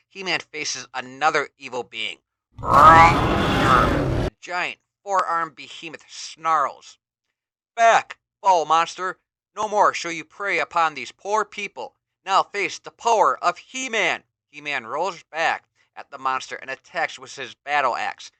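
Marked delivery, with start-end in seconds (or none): 2.6-7.0 slow, drawn-out, much louder beastly snarl; monster snarl. A narrator's reading. Narrator voices monster snarl. 7.7-14.2 louder, higher heroic battle cry; heroic challenge. Acted. Hero confronts monster.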